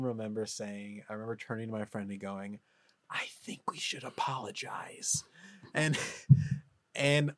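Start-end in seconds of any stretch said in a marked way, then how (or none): 3.1-5.2 hushed, voiceless whisper; secretive whisper from a hiding place; retold whisper. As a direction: whisper it to a friend while hiding. Whispering to friend while hiding.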